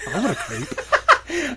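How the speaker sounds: high, slow, laughing goofy voice; laughing silly voice. Real, not acted. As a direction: crack up while saying it. Joking about crepes, laughing.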